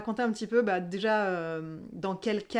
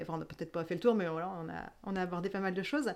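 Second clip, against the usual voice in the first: usual speaking voice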